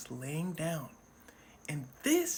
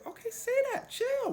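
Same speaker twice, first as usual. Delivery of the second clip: high mock placating. Police backing down.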